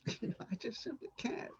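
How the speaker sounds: unvoiced, breathless whisper. Troy alone, whispering that he simply can't. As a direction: whisper it to yourself, overwhelmed.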